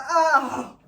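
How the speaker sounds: half-hearted shocked exclamation; mock dismayed gasp. Owner leaves the scene dismayed, a mock Oh. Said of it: "She goes".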